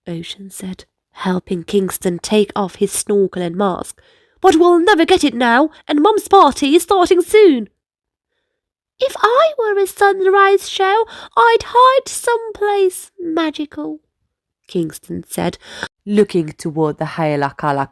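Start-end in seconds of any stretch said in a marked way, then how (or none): 4.4-7.7 put-on fast, louder worried boy; fretting about the party; hurried worry. 9.0-14.0 put-on higher, dreamy little boy; small child imagining magic; little brother. Little brother Kingston voiced.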